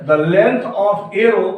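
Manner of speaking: each word drawn out very slowly; slow dictation. Shown as a demonstration. Teacher repeating the definition for students, very slowly. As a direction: lay every word down for the class to copy.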